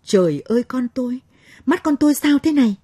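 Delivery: high, loud frantic cry; a mother in sudden terror; panicked mother. A narrator's reading. Mother seeing her child's burned eyes, frantic and afraid. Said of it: "Cô Mão hốt hoảng".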